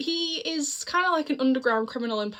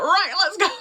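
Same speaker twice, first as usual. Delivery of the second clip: higher, louder "Right, let's go" as the prisoner; eager shout; 8 semitones higher, 7 dB louder than usual. Retold or imitated. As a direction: burst out ready to escape. Prisoner breaks out at once.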